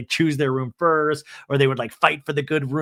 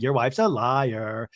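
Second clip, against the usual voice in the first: "Your wife's a liar" chanted slowly; slow singsong imitation, drawing out "liar"; sing-song; 3 semitones lower, slower than usual. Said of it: "I love how she always sing-songs it".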